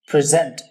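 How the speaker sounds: Present with the stress on the second part, pre-ZENT; verb stress. Shown as a demonstration. Teacher showing the verb form: present, stress on the second part. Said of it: "second part पर stress है".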